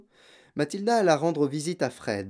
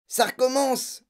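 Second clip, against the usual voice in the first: high, startled exclamation; alarmed cry; 12 semitones higher than usual. A narrator's reading. Brother hearing the voice once more, alarmed cry. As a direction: cry out in a raised pitch. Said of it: "cria le frère".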